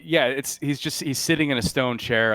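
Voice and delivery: normal talking voice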